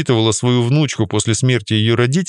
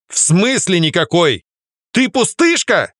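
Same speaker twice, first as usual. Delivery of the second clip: higher, slow, incredulous rising anger; rising to a shout; 7 semitones higher, slower than usual. A narrator's reading. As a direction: build toward a shout. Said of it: "Его голос уже переходил на крик".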